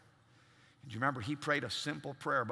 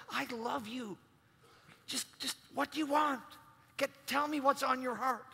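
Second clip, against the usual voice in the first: tender loving voice; 9 semitones higher than usual. Retold or imitated. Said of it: "God's like".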